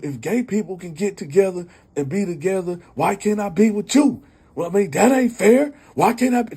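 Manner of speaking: high, loud indignant complaint; getting loud. Retold or imitated. Uncle at her window complaining he's treated unfairly, high and loud. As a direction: raise it up, pleading he's been wronged. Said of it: "Now he getting loud".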